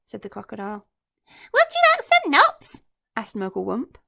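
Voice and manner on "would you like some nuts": much higher, louder cheeky greeting; chirpy monkey. Acted. Mugglewump greeting the crocodile, high and chirpy.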